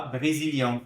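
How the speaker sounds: "Brésilien" with a nasal ending; nasal vowel. Shown as a demonstration. Teacher repeating the nasal vowel. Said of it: "Pronuncia aqui com o nariz".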